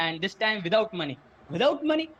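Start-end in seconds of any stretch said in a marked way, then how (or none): 1.6-2.1 high incredulous echo, rising sharply; shocked question. Acted. Boy to rich dad on hearing the unpaid terms, shocked. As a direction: throw it back in acted disbelief.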